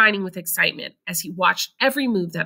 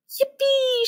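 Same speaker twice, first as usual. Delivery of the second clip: high whoop of joy; grandpa's delighted shout; shouted yippee. A narrator's reading. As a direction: whoop like on a rollercoaster. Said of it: "shouted Grandpa Joe".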